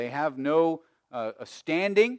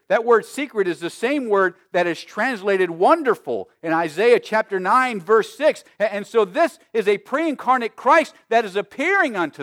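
loud, high, emphatic pulpit teaching; preaching at full force; 5 semitones higher, 8 dB louder than usual. Real, not acted. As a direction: build the point forcefully.

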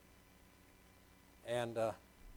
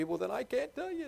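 higher, put-on teen voice refusing; imitating a teenager; 10 semitones higher than usual. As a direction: play the sulky teen, pitched up. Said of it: "they say to me".

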